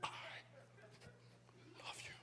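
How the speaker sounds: very soft, whispered insistence; hushed insistence. Retold or imitated. Dad on the stretcher to his son, insistent whisper. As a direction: whisper it, pausing between the words.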